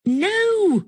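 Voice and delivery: very high, drawn-out cry; distressed protest. Acted. Refusing to be sent into his mouth, high wail.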